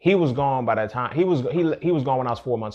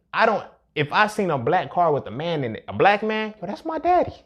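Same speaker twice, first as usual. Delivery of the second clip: rising excitement; 7 semitones higher, 3 dB louder than usual. Retold or imitated. Retelling childhood hope, pitch climbs.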